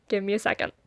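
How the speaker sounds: a quiet, choked-up plea for a moment. Real, not acted. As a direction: hold back tears, barely voiced. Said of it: "Okay, I'm good".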